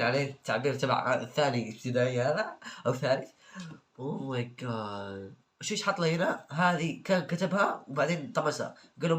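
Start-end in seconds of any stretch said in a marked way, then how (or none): 4.0-5.3 drawn-out, deflated oh my god; exasperated sigh. Real, not acted. Reacting to a student's essay, drawn-out disbelief. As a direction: sound completely defeated by the answer.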